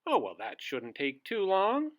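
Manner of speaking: loud, cheerful wizard. Acted. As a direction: boom it out like a jolly wizard. Wizard voiced in read story.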